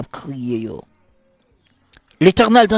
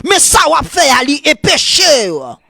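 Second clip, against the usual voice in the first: high, fast-building revival sermon; full-force preaching; 10 semitones higher, 3 dB louder than usual. Real, not acted. Preacher warning the church about sin, full-force preaching. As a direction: drive each phrase high, then drop on the ending.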